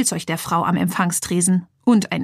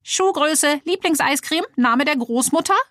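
higher, playful little phone voice; a cheeky toy telephone chiming in; talking telephone; 7 semitones higher than usual. A narrator's reading. The violet phone adds silly questions, higher and playful. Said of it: "ergänzte das violette Telefon".

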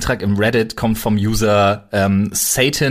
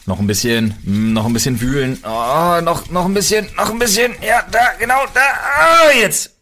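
rising higher and louder; building excitement; 6 semitones higher, 4 dB louder than usual. Real, not acted. Urging partner to stop drawing. (von ruhig zu aufgeregtem Ausruf)

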